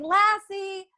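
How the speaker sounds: high call across the lake. A narrator's reading. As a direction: call the dog's name from far off, rising. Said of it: "Timmy calling".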